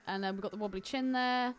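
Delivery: ordinary delivery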